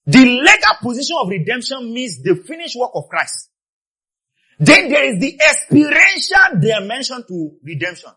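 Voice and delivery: high, loud sermon cry; impassioned preaching. Real, not acted. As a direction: proclaim it to the whole congregation. Preaches at full force.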